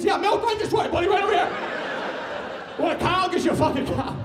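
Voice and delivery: loud giddy stoner clerk; overeager clerk. Retold or imitated. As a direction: gush like a way-too-high employee. Voicing overeager Target employee.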